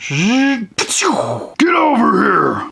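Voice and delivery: slow, dragged-out announcer call; fighting game announcer. Pretend. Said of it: "that announcer coming from".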